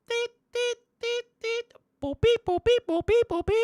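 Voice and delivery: very high mock beeps 띠 띠 뽀삐; imitated beeping. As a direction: squeak like an electronic chime. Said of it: "You wanna hear more beeping sound?".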